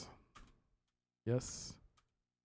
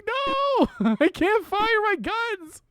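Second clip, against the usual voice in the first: laughing exasperation; 4 dB louder, slower than usual. Real, not acted.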